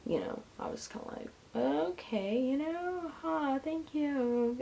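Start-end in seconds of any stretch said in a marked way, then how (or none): 1.5-4.6 awkward thanks. Retold or imitated. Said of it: "I'm so awkward".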